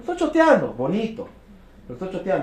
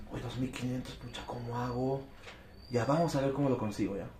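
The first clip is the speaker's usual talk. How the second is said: softer, worried but willing to find the money; eager but worried; 5 semitones lower, 15 dB quieter than usual. Acted. Keen prospect worrying softly how to pay. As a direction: fret quietly about the money, then commit. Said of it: "¿cuál de los dos tenía una mejor actitud?".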